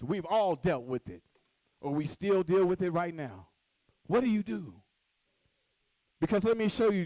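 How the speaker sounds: usual speaking voice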